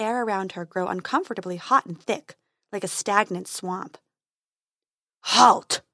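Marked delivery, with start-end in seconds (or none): 5.3-5.8 loud booming halt; booming dragon. A narrator's reading. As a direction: boom it from the dark. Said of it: "boomed a voice from the darkness".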